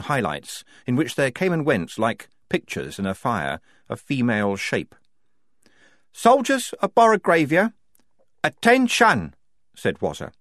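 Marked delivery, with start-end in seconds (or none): 6.1-9.3 thin reedy voice. Acted. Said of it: "underneath her reedy little tone".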